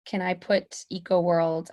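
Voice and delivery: ordinary delivery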